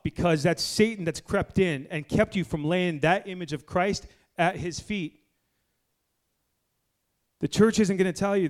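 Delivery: loud, rising sermon at full force; fiery preaching. Acted. Preacher warning his church, loud fiery preaching. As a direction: pastor hammering the point home to his congregation.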